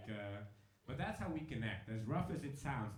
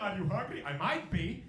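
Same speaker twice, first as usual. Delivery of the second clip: higher, louder, spoiling for a fight; raised-voice comic confrontation; heated mock exchange; 7 semitones higher, 7 dB louder than usual. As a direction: act out a brewing fight, loud and raised. Fight waiting to happen, acted exchange.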